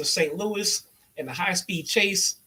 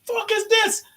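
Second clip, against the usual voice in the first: much higher, louder mock yelling; imitating J R furious; 6 dB louder, slower than usual. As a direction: screech it like an outraged commentator. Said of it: "J R is fucking pissed".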